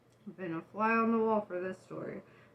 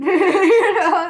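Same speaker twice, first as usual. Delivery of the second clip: louder laugh through the words; 7 semitones higher, 13 dB louder than usual. Real, not acted. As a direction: laugh hard on it. Laughing hard.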